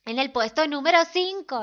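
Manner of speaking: loud countdown announcer; MTV countdown host. Retold or imitated. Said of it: "Parecían los programas de MTV".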